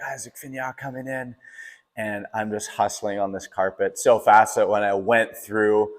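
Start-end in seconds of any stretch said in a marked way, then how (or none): 0.0-1.4 distant-sounding announcer call, higher and softer; imitated race announcer. Announcer bringing runner home, imitated announcer call. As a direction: imitate a finish-line announcer without the volume. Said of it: "but they're like".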